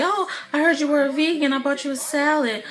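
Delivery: mock eager interest. Parent trying to seem into the kids, mock eager. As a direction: feign keen interest in your child.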